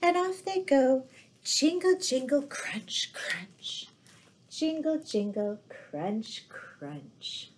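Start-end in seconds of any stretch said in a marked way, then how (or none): none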